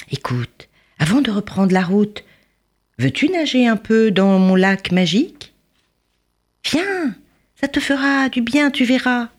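high, gentle fairy voice inviting; kind fairy. A narrator's reading.

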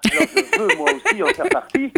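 laughing through the words. Real, not acted. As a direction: giggle while saying it. Jokes about being jealous of Gad.

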